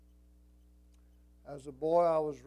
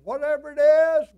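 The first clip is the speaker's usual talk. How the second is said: sudden high, very loud cry; preacher's climactic shout; 10 semitones higher, 18 dB louder than usual. Real, not acted. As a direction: build to it and holler. Climax of exhortation to congregation.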